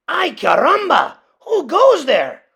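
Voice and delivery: loud, high alarmed call; startled challenge. A narrator's reading. Kitten challenging the band of dogs, loud and startled. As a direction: call out at the strangers. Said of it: "asked Skippy John Jones".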